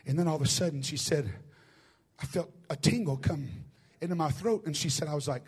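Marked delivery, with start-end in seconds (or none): none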